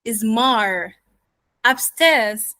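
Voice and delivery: New York "Mar" drawn into upstairs, tone lifted; New York accent with linking R in "Mar upstairs". Shown as a demonstration. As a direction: ask it as a New Yorker, lifting "upstairs". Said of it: "went upward with my tone".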